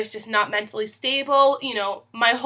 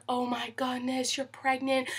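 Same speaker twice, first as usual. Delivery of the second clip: stunned hush; 11 dB quieter than usual. Retold or imitated. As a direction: imitate a friend too shocked to speak up. Roommate stunned by result.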